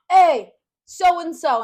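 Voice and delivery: loud, drawn-out call through a door; brothers calling out. Retold or imitated. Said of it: "They knock on the door".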